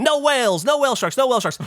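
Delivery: high, repeated call-out; lightly mimicked radio report; radio chatter. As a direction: imitate boat crews calling over the radio. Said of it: "chatter over the radio".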